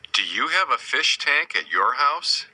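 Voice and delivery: normal talking voice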